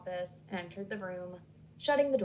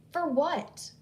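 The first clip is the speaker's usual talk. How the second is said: sharp demand, higher and louder; 6 semitones higher, 5 dB louder than usual. Acted. Hillary demanding why Emily visited, sharp and loud. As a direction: snap the question back at her. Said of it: "she demanded to know".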